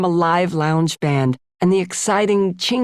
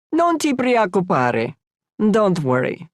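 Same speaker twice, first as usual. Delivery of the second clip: reassuring Italian; 3 semitones higher than usual. Acted.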